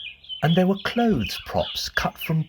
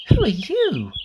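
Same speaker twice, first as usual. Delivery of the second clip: a hint of a curious little girl, higher; lightly voiced little girl; 7 semitones higher than usual. A narrator's reading. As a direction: ask like a small girl, wide-eyed. Lucy voiced in story.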